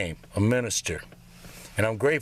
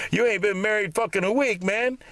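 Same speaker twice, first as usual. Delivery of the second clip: higher, mock-incredulous taunt; mocking friend; 7 semitones higher than usual. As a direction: needle him about the short marriage. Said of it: "people started asking me".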